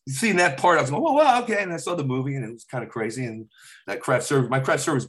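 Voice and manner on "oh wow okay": high, loud amazed reaction. Retold or imitated. Teller seeing the scene filmed, high and amazed. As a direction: gasp it out in wonder. Awed on set.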